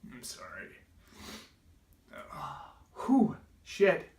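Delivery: real, overwhelmed sigh, then a loud breath out; collecting himself after tears; emotional. Real, not acted. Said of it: "just bringing back the memories".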